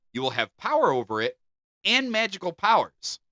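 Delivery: ordinary talk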